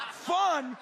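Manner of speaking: high, loud mock disbelief; incredulous. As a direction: toss the word back, astonished.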